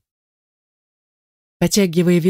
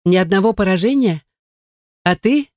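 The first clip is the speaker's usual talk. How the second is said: raised voice over noise; 5 semitones higher than usual. A narrator's reading. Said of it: "не слыша себя, спросила".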